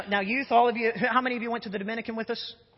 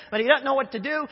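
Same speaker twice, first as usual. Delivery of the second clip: ordinary talk, nothing marked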